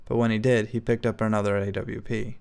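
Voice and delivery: usual speaking voice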